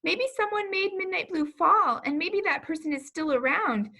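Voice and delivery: timid character voice; worried wondering. Acted. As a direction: fret that danger is still near. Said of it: "She wondered".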